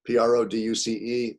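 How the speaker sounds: normal talking voice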